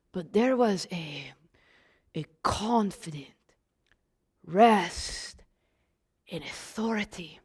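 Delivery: near whisper, low, with long pauses; hushed gentle authority. Shown as a demonstration. Follower recalls Jesus teaching with authority, hushed and low. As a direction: drop very soft and let each word rest.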